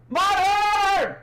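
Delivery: loud excited shout. Real, not acted. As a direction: call it out full voice. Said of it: "Sorry, I'm being loud".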